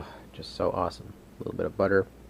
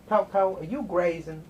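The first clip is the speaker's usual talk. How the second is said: talking to the dog; 9 semitones higher than usual. Real, not acted.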